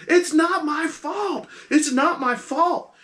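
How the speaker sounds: she's losing control, shrieking her innocence; frantic shriek. Acted. Captive woman ignoring the hush, shrieking in rising panic. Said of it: "she's going".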